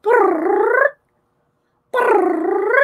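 high rolled cat chirrup; imitated cat trill. Imitating the cat Malibu talking, high trilled brrr. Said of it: "I hear Malibu going".

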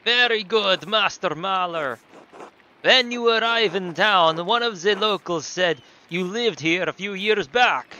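put-on loud, slow, accented innkeeper; big drawn-out host voice; Nico from GTA IV. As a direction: present the house in a hammed-up foreign accent. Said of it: "do Nico from G T A four".

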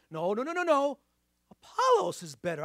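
mock squabble, jumping high on the rival claim; contradicting partisan. Preacher mimicking the rival Corinthian faction, contradicting.

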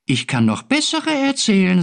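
high boastful creature voice; other little creature. A narrator's reading. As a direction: brag as a tiny being. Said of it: "sagte das andere".

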